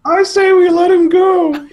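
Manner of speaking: Pee Wee Herman. Retold or imitated. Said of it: "classic Pee Wee".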